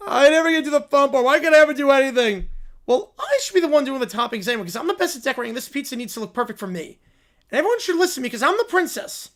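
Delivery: put-on high, loud, spoiled childish whine; a spoiled little princess bossing everyone; bratty princess.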